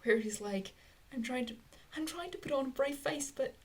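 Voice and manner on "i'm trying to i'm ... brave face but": lightly put-on British accent, pitched up. Fan voicing a pony putting on a brave face, British accent. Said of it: "that was British accent".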